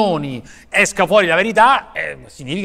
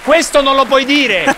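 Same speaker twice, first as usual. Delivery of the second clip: high, loud, slow scolding shout; mock host yelling; 7 semitones higher, 6 dB louder, slower than usual. Talk show host scolding an unruly guest, loud high shout. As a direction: bellow it like a host losing control.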